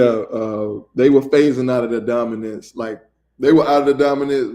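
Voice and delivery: normal talking voice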